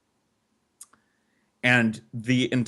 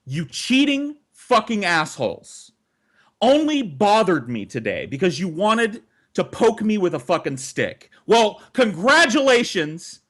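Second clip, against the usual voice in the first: heated rant; 6 semitones higher than usual. Retold or imitated. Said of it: "I get more and more heated".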